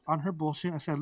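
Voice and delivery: usual speaking voice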